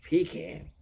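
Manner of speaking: "Pecan" said as PEE-can; mocked pecan. Ex-girlfriend making fun of him, "Pecan" as PEE-can. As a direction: say "Pecan" with a long EE, then can. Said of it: "always make fun of when I said that".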